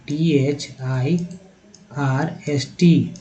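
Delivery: letters spelt out very slowly; slow spelling. Shown as a demonstration. Teacher spelling thirst for students, slowly. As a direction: spell each letter deliberately. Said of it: "thirst का कैसे spelling हुआ".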